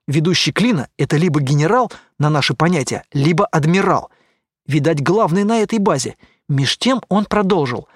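ordinary delivery, nothing marked

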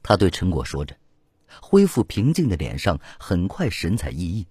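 ordinary delivery, nothing marked

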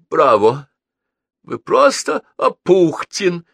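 high, loud drawn-out bravo; exclaiming admiration, voice flung up; delighted exclamation. A narrator's reading. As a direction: acclaim the poet with gusto. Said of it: "воскликнул Старжин".

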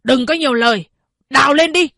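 rising to a high, loud final command; curt order. A narrator's reading. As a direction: cut him off and bark the order. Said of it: "nói dứt khoát".

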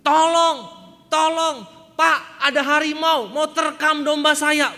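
very loud, high, drawn-out cry for help; shouting for help. Retold or imitated. The shepherd fakes a tiger attack to the villagers, loud high shout. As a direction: scream that a tiger is coming. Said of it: "Lalu dia teriak lagi".

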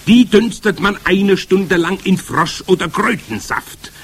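high, loud witch voice; commanding Grand High Witch. A narrator's reading. Witch leader starting to explain her recipe, commanding.

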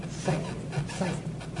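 barely audible, voiceless whisper. Shown as a demonstration. Preacher answering his own question to class, whispered. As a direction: whisper it as a tiny prompt. Demonstrating ways to say it.

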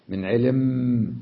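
علم with its م drawn out and hummed; slow علم ending on a long mmm; doubled nasal final mim. Shown as a demonstration. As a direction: stretch the last م of علم through the nose. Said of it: "وتشددها وتغنها".

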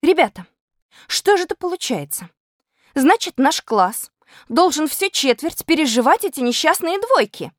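put-on high-pitched, flustered girl; alarmed. A narrator's reading. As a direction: appeal to classmates in high alarm. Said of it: "всполошилась Кузякина".

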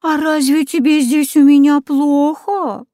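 high, wheedling crone; sly old witch. A narrator's reading. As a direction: coo at her falsely. Yaga voice.

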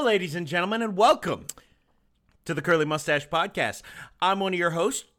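deep, soft show-host voice; low announcer intro. Acted. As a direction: welcome the audience in a low, smooth register.

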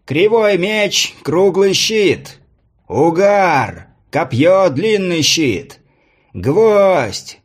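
high, slow, drawn-out announcer call; herald calling out. A narrator's reading. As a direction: stretch each name out across the arena. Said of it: "закричал глашатай".

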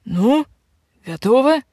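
bright, happy announcing; cheerful announcement. Acted. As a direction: declare it gladly. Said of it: "радостно заявила ведьма". (радостно объявляя)

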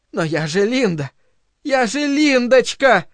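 rising, desperate plea; insist, climbing high on the second phrase. A narrator's reading. Linda clinging as the director pulls away, desperate. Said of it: "цепко и отчаянно".

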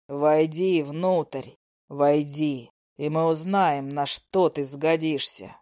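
low, slow dragon voice with contempt; menacing dragon. A narrator's reading. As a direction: loom over the foolish human child, drawing words out. Dragon speaks to heroine.

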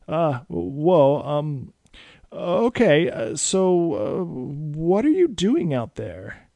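put-on high, hesitant, slow boy's voice; a scared kid stalling for words; nervous stammer. A narrator's reading. Frightened boy stammering at the vampire. Tommy shivered.

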